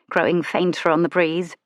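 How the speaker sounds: usual speaking voice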